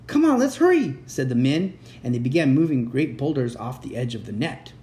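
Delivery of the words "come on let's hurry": high, loud urgent call; rally the others to rush; high-pitched storybook urgency; urgent hurrying. A narrator's reading. Men rushing to the rescue.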